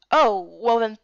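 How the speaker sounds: ordinary delivery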